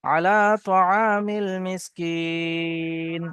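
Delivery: slow drawn-out melodic chant; Quran recitation. Acted. Teacher reciting the verse for students, slow melodic chant. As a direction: intone it slowly, stretching the vowels.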